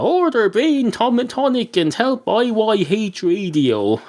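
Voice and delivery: high, slow ad-copy read of Order Brain Tonic; mock ad slogan. Host reading his own website ad, slow high mock announcer. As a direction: read the web page slogan like a cheesy ad. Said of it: "it says".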